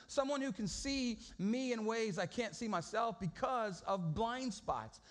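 usual speaking voice

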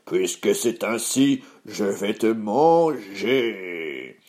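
slow, drawn-out troll roar; menacing troll, very slow; howling troll. Acted. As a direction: stretch the threat out like a hungry monster. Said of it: "et hurla".

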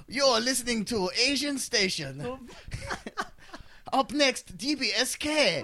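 co-host hamming up a fake radio show intro, mock DJ voice; cheesy radio DJ. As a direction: sell the station like an over-the-top radio host. Continues radio DJ bit.

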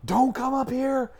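high-pitched imitation of mom's warning yell. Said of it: "my mom is yelling".

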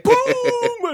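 high, loud boom; mock boom. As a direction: yell the kick impact. Kid kicking the doll.

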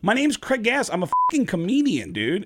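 high, emphatic reveal; exasperated confession. Retold or imitated. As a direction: blurt out who you really are. Confesses the prank to singer.

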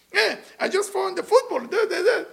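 high, chattering husband voice with a laugh; mock babbling husband. Teacher mimicking husband babbling about football, high and laughing. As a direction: rattle on like a sports-mad husband.

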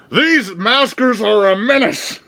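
loud, high newspaper-editor rant; J. Jonah Jameson. Retold or imitated. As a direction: bellow it like an angry editor. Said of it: "J K Simmons as J. Jonah Jameson".

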